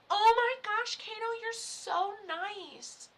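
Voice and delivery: high, loud gasp then slow gush; mock gushing gasp. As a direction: play the crowd's overdone gasp. Imitating everyone's gasp.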